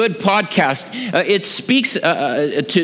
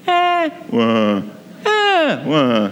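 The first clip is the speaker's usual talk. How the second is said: imitating a baby's eh-wah, dropping low on wah; mock German baby cry, high then sharply low; baby cry falling high to low; much slower than usual. Shown as a demonstration. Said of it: "They cried from a high to a low pitch".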